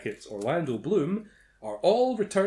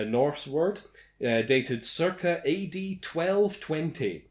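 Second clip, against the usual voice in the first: usual speaking voice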